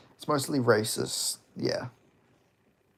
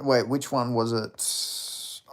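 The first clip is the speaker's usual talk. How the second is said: ordinary talk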